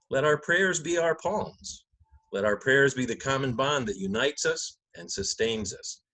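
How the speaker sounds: normal talking voice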